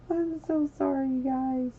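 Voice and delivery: high, bright burst of sorry; high, rushed sorry to chat; gushing apology. Real, not acted. Frantic apology to neglected chat.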